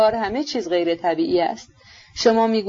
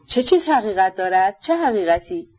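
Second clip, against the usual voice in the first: usual speaking voice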